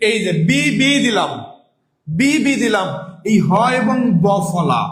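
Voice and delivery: ordinary talk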